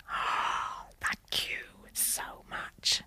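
slow, hushed whisper of gratitude; whispering thanks to a dying sister. Retold or imitated. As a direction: breathe out thank you so much, moved. Grateful reply to dying sister.

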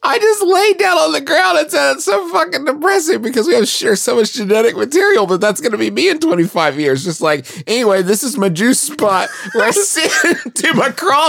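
high voice, laughing. Real, not acted. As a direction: tell it through giggles. Laughing while retelling.

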